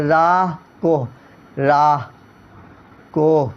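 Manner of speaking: راہ slow, with an audible h at the end; sounded final h. Shown as a demonstration. Teacher demonstrates ha-e-malfoozi, audible final h. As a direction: breathe out the h of راہ before کو. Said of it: "جو بولنے میں last میں آتا".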